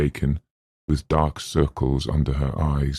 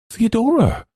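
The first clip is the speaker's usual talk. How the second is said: much higher anxious call; frightened call; 4 dB louder than usual. Acted. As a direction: reach for her friend in fear. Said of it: "she tried to ask".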